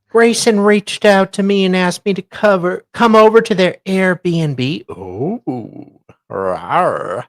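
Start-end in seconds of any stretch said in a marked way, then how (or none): 4.9-7.2 low teasing rawr; playful growl. Pretend. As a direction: purr it like a flirty cat. Teasing about a romantic meetup.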